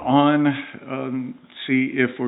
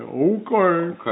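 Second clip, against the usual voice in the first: mock neighbor; 6 semitones higher than usual. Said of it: "She's like".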